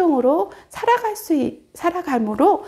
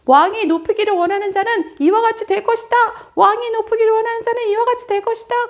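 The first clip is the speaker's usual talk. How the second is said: higher, louder herald's cry; public proclamation; 8 semitones higher, 4 dB louder than usual. Acted. Haman parading Mordecai, shouting to the crowd, loud proclamation. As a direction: call it out to the whole crowd. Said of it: "그렇게 큰 소리를 외치고".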